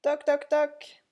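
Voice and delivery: high, slow spoken knocks; knocking sound. A narrator's reading. Narrator imitates knocking at sick clown's door, high and slow. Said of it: "on toqua à sa porte".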